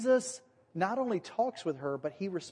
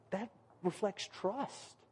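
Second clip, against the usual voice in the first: quiet approving reply; 3 semitones higher, 7 dB quieter, slower than usual. Retold or imitated. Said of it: "by going".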